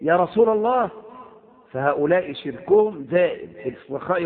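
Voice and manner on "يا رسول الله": higher, louder pleading call; crying out. Retold or imitated. Preacher imitating people calling saints, raised cry. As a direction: call out as if in danger. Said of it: "قال".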